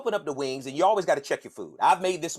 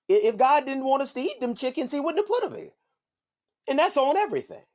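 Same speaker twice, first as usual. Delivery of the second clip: high mock sermon; 10 semitones higher than usual. High preachy proclamation.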